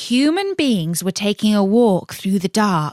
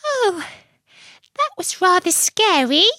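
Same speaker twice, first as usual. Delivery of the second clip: very high, small creature voice; little Tim's high voice; 11 semitones higher than usual. Acted. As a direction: squeak it like a tiny frightened animal. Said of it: "little Tim said".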